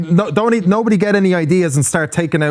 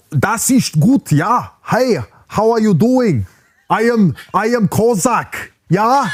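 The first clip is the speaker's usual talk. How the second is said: slow, hammed-up German accent; bad German accent; slower than usual. Contestant showing off in the accent game, hammed-up German accent. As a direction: put on a rough, wandering German accent. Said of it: "a bit off the German".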